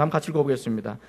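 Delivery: normal talking voice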